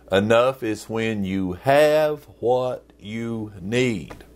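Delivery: slow, each word spaced out; said really slowly. Shown as a demonstration. Pastor repeating the definition to the congregation, slowly. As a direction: lay it down slowly so it sinks in. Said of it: "I'll say it really slowly".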